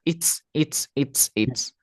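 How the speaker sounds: "eats" ending in a hissed voiceless s; repeated short "eats" with plain s; simple S. Shown as a demonstration. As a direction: drill "eats" with a crisp ts, no buzz. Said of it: "la S está simple".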